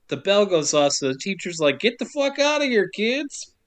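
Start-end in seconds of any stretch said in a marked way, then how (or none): 1.8-3.5 teacher yelling. Retold or imitated. Teacher shooing kids out.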